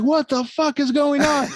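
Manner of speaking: high, panicked mock yell from mom; mom freaking out. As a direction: play the frantic mom yelling over the smoke. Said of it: "She's like".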